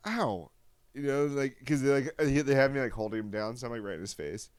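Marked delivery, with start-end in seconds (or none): 0.1-0.5 high-pitched imitated "ow"; mock yelp of pain. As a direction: imitate a kid feeling the jab. Retold child's reaction to shot.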